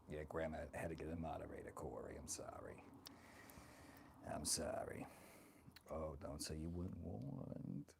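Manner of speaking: lightly voiced doting old grandma; sassy old granny, chatty and fond; grandma character. Streamer playing grandma, chatting with her viewers.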